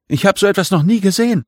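high, fast cry; shouting across. A narrator's reading. Marit cries over to her companions in the tent, shouting across. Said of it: "schrie Marit zu uns herüber".

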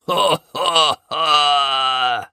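lautes, dröhnendes Ho-ho-ho eines Löwen; raues Lachen. A narrator's reading. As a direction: lach rau und mächtig. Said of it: "ein raues Lachen". (raues Königslachen)

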